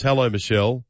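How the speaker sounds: normal talking voice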